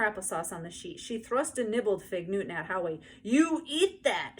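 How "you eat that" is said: put-on high, slow, bossy little-girl demand; drawn-out bossy child's command; bossy shout. A narrator's reading. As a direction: order him to eat it like a furious four-year-old. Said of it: "she repeated".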